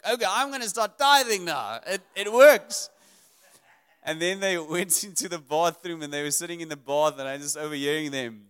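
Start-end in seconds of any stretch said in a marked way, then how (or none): 0.0-2.9 put-on eager kid. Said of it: "He's like".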